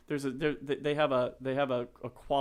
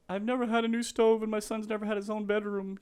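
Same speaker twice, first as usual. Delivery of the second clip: higher-pitched imitation of a tearful mom; retold tearful first-time buyer; 10 semitones higher than usual. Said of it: "she's crying".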